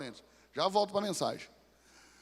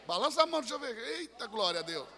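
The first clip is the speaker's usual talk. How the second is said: ordinary talk, nothing marked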